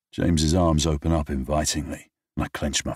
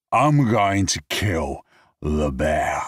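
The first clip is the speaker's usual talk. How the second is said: put-on drunken vow; 4 dB louder, much slower than usual.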